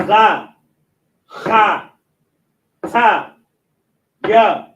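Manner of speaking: ordinary talk, nothing marked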